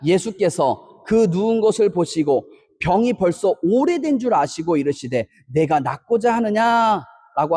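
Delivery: slow, measured reading of the verse; scripture reading aloud. Acted. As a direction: read it slowly together with the congregation. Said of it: "같이 읽겠습니다. 시작". (천천히 성경 봉독)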